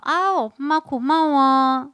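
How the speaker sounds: slow, drawn-out mock gratitude; grateful baby. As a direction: imitate a baby gushing thanks to mom. Imagined baby thanking mother.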